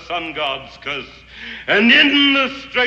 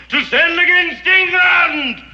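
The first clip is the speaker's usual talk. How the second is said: triumphant shout; 10 semitones higher, 4 dB louder than usual. Acted. Climax of the armada tale.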